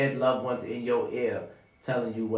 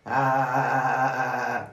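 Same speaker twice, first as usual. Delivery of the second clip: loud mock laugh; 5 dB louder than usual. Laughing off friends' bullshit.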